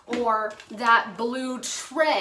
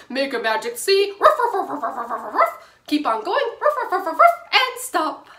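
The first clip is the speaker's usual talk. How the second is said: barking like a dog; 3 semitones higher, 6 dB louder, faster than usual. Shown as a demonstration. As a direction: bark the words like a puppy. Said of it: "bark like a dog".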